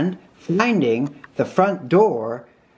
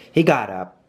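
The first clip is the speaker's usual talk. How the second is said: plain reading voice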